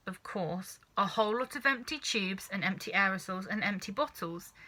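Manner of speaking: ordinary delivery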